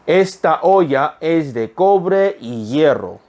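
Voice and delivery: slow, deliberate repeat; slow reading. Shown as a demonstration. Teacher repeating the sentence slowly for students. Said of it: "한 번 더".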